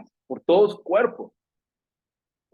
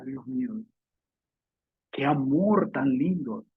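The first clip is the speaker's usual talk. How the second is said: ordinary talk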